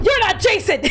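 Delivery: high, loud outraged shout; mad. Retold or imitated. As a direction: yell it as a hammed-up accusation. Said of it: "I got mad again".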